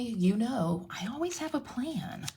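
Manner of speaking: slow, soft confident promise; calm them down, you have a plan; quietly assured; reassuring. Real, not acted. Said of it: "reassuring the viewers".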